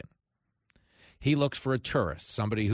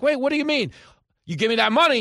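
high, loud demand for money; loud pushy hustler; demanding grifter; 12 semitones higher, 7 dB louder, faster than usual. Acted. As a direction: shove the demand at him. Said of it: "And the guy's like".

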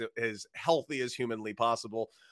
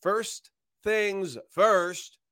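dramatic slow intro; 5 semitones higher, much slower than usual. Acted.

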